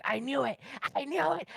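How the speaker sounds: hammed-up triumphant mimicry; mock gloating. Mimicking people gloating at being right.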